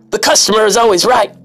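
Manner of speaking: high mock shout of the customer; mocking imitation. Mocking the imagined retail manager, high sneer.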